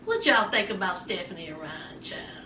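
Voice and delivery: unvoiced gossipy whisper. Real, not acted. Gossip aside to viewers.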